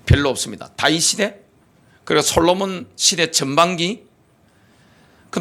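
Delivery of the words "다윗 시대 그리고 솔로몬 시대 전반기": ordinary delivery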